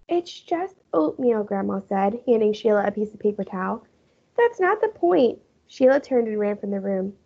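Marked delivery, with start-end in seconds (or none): 0.1-1.4 slow, higher gentle grandma voice; calm grandma. A narrator's reading. Grandma calmly calming Sheila over oatmeal. 4.4-5.4 put-on higher, slow indignant girl voice; upset girl. A narrator's reading. Sheila snapping back at Grandma.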